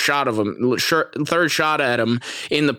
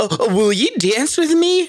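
much higher, slow, timid put-on nerd voice; nerdy prom boy; 14 semitones higher, 3 dB louder than usual. Nerd asking the hot girl to dance, mock timid. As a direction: ask for the dance like a nervous dork. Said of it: "I look like the fucking nerd at prom".

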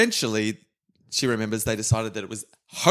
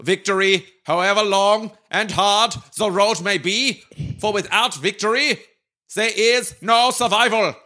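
rousing speech ending in a mock German accent, higher; 7 semitones higher than usual. Finishing Churchill's speech for a daughter, in a German accent. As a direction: build the close in a German accent. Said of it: "with a German accent".

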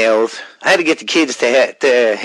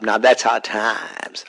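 Dusty Rhodes impression; 3 semitones lower, 5 dB quieter, slower than usual. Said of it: "I don't mean to sound like him".

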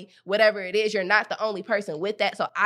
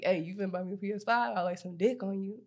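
mock man's pickup line; 4 semitones lower, 7 dB quieter than usual.

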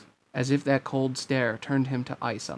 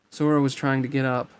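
ordinary delivery, nothing marked

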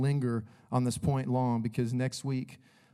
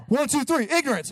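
chanted, much higher and louder huddle break; high, loud mock locker-room chant; mock team cheer; 12 semitones higher, 6 dB louder than usual. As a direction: shout the count like a hammed-up coach.

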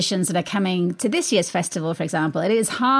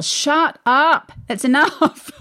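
raised, higher-pitched exasperated outburst; louder, higher pretend shout of protest; mock yell; 7 semitones higher, 4 dB louder, slower than usual. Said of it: "I just wanna yell".

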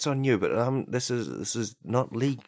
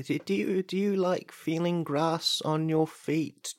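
a slow, soothing ad pitch; dreamy ad read; 5 semitones higher than usual. Acted. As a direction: lull them into bare feet, slowly. Ad read for feet.

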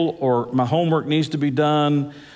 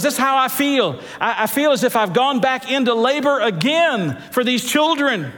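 high, raised preacher voice carried over a laugh; fervent preaching; 8 semitones higher than usual. Acted. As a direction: preach it with rising fervor. Preaching at full force.

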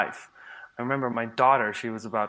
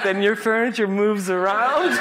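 laughing; 7 semitones higher, 5 dB louder than usual. Real, not acted. Laughing through punchline.

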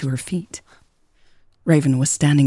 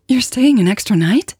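high, louder excited squeak; 7 semitones higher, 4 dB louder than usual. A narrator's reading. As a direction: squeak it out, unable to hide excitement. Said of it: "she squeaked, unable to hide her excitement".

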